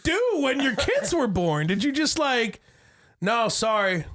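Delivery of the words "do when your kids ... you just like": high, slow disbelief with a laugh; mock outrage at the cousin; incredulous. Dad scolding a cousin about diapers, high and incredulous. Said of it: "retold incredulous reply".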